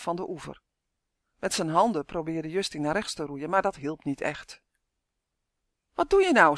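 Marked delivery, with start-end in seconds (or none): 5.9-6.6 loud, high-pitched yell; high, urgent storybook shout; panicky shout. Acted. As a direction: shout it out in alarm. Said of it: "schreeuwde Uf".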